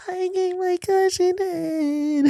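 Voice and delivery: very high, slow sing-song [name]; mock [name]. Vlogger imitating [name]'s delivery, very high and slow. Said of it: "That's how he said it".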